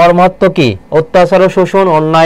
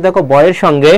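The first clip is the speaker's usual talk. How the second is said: normal talking voice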